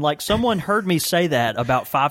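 ordinary talk, nothing marked

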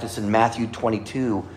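ordinary talk, nothing marked

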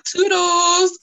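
high, loud drawn-out call; excited cartoon call. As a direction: sing out the name like summoning a cartoon helper. Acting out calling for Toodles.

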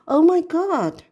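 high, loud oh my God; sudden shock as it hits; shocked exclamation. Retold or imitated. Recalling the high hitting.

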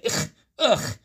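a high, exasperated "ugh", then a scornful retort; rude clerk. Acted. The clerk rudely answers Jabari's price question, high and scornful. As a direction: brush off a dumb customer with disdain. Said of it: "un poco grosera, me responde".

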